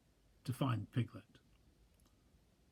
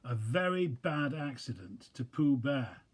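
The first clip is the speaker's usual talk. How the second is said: ordinary delivery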